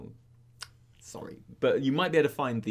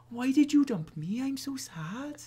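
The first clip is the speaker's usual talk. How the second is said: higher, slow, hammed-up sad teenage boy; mopey teen boy; 13 semitones higher, slower than usual. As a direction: whine it out like a dumped sixteen-year-old, mock-tragic. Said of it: "And I was like".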